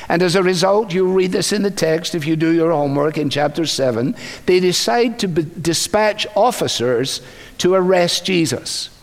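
usual speaking voice

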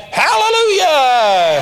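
high, long, loud hallelujah; mimicked praise shout. Players copying him after a fumble, mock praise shout. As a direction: holler it out, stretched and falling. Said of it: "Every time they messed up, they'd go".

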